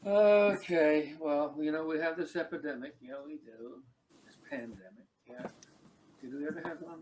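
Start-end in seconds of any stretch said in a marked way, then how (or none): 0.1-2.9 far louder, calling out. Acted. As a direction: belt it to the back row. Loud restart of the routine.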